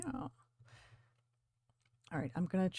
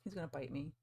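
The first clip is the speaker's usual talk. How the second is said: ordinary talk, nothing marked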